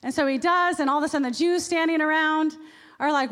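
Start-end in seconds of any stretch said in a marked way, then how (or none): none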